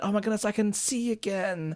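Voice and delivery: imitating a thrilled girl who can finally see; excited. Toph imitated, excitedly thrilled to see again. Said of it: "Toph is all excited".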